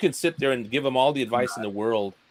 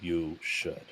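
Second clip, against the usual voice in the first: very slow, lower-pitched monotone; charisma-free drone; 6 semitones lower, 4 dB quieter, much slower than usual. Acted. Imitating dull YouTubers pitching their videos, slow drone. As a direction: plod word by word with no interest. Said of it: "they sound like this".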